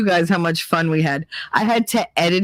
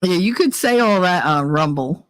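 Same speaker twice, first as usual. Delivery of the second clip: normal talking voice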